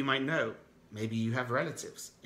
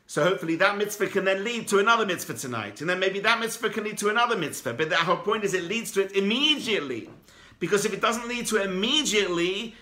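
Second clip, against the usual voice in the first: higher and louder, urgent and pressing; rally them to act tonight; preaching at full force; 7 semitones higher, 6 dB louder than usual. Real, not acted. Rabbi urging listeners to give charity now, fervent preaching.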